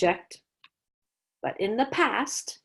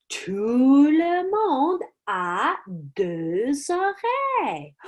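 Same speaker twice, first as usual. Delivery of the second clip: slow, rising to a much higher finish; dramatic reveal; 4 semitones higher, much slower than usual. Shown as a demonstration. Teacher dramatizing the clue for students, slow and rising. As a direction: drag it out, then peak.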